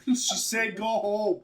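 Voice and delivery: loud, playful telling-off; pretend yelling down. Pretend neighbour sending Sharoma's friends home, loud. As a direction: shout them away in jest.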